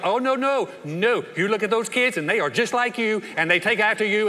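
loud, high-pitched mock retort; arguing back. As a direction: fire back in a hammed-up family squabble. Acts out the spouse's comeback.

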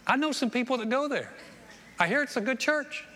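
high-pitched imitation of polite small talk; chatty stranger. Retold stranger chatting with Joy.

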